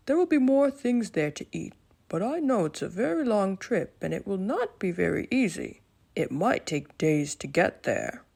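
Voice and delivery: calm, wise lion voice; a thoughtful king reassuring his friends; Leo the lion. Acted. Leo continues.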